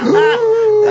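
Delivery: sharp high mock gasp of a shocked audience; crowd gasp. Comedian imitating a shocked audience: sharp high gasp. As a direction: gasp like a scandalized room.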